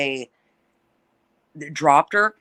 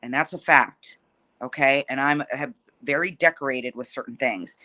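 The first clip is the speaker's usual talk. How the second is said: ordinary delivery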